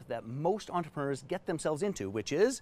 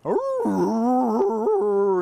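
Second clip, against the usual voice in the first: sudden loud, high dog howl; howling dog groan; 7 semitones higher, 9 dB louder than usual. Retold or imitated. Hound dog lying on a nail, loud pained howl. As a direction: let out a pained dog's yowl. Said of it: "But a moment or two later".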